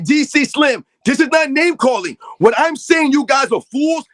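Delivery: loud, heated callout; angry shout. Real, not acted. Host calling out D C Slim by name, loud and angry. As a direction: yell it right at the chatter.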